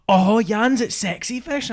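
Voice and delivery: high, loud, drawn-out Scottish exclamation; hammed-up Scottish astonishment; Scottish accent. Friend mimics a friend's amazed news, Scottish accent. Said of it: "in that really Scottish accent".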